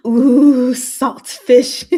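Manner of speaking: light up at the pick; thrilled, loud ooh of approval; delighted ooh. Real, not acted.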